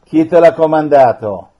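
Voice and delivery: loud, forceful orders; stern command. Real, not acted. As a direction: command it to speak. Priest commanding a spirit.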